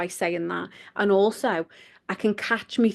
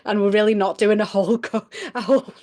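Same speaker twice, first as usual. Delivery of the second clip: loud giggly protest, cracking up; laughing through the words; 6 dB louder than usual. Real, not acted. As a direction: try to explain while giggling. Giggles after saying masturbation slip.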